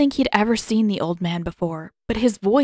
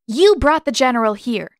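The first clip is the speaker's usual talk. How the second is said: louder, sharp retort; 5 semitones higher, 6 dB louder than usual. A narrator's reading. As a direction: bite the words out. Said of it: "she said sharply".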